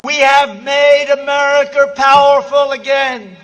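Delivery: booming rally crescendo, rising chants; rally speech. Real, not acted. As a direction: shout each line to a roaring crowd.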